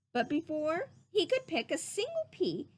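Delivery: ordinary delivery, nothing marked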